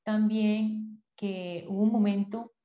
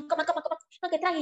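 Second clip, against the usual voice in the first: higher, hurried coaxing; coaxing to eat; 8 semitones higher, faster than usual. Retold or imitated. Mother pushing the family to eat, higher and insistent. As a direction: urge them to eat, voice lifted. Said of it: "y uno trabaja y éramos".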